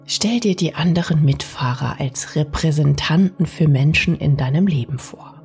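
usual speaking voice

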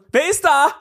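high, loud questioning "Wer ist da?"; asking who is there. Shown as a demonstration. Host demonstrates the Russian phrase as a question. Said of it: "Stell dir vor, jemand schreibt".

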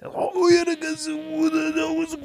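squeaky fake boss; high fake voice. Fake boss answers in scene.

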